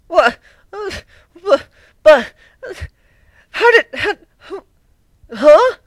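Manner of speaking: very high, loud stammering shock; panicked confusion. Acted. Twilight losing her voice magic, high shocked sputter. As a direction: sputter in disbelief. Twilight's brain about to break.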